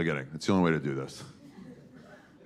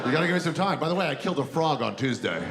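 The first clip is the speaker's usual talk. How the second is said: Adam voice; 6 semitones higher than usual. Acted. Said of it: "And Adam was like".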